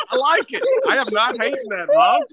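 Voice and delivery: usual speaking voice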